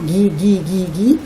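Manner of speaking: "gui" repeated in a searching, questioning chant; repeated syllable. Shown as a demonstration. Teacher prompting a child to find words starting with gui, repeating. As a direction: keep repeating "gui" as if hunting for the word. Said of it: "On peut répéter la première syllabe".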